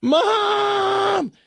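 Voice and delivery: high, loud mock wail of Mom; hammed-up hysterical cry for mom; hysterical shout. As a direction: yell it like a panicked son. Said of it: "and he is hysterical".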